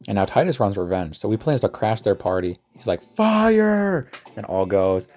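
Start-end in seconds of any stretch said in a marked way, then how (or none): 3.0-4.1 high, stretched shouted command; hammed-up order to attack; mock battle cry. Titus crashing their party, mock battle cry.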